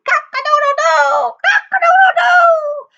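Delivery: very high, loud rooster crow. A narrator's reading. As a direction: crow it out at full volume. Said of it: "He was crowing so loudly".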